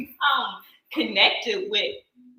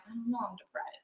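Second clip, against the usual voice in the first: soft, deflated answer; barely bother to lift the voice; weary sad; 2 semitones higher, 12 dB quieter than usual. Retold or imitated. To her oldest son asking about her day, soft and weary.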